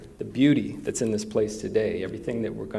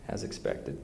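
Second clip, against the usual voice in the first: ordinary delivery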